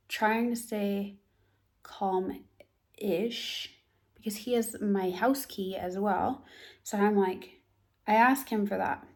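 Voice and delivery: ordinary talk, nothing marked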